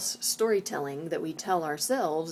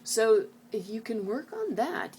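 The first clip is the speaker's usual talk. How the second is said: normal talking voice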